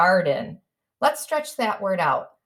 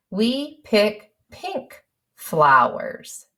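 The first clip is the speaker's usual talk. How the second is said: very slow, word by word while writing; slow dictation; much slower than usual. Shown as a demonstration. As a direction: dictate each word slowly as you write it. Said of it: "That's what I'm going to say next".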